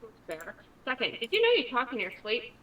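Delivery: normal talking voice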